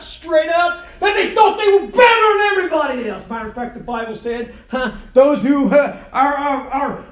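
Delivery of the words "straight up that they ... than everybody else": high, loud shouted preaching; preaching at full force. Real, not acted. Preacher shouting to the congregation about Nazareth.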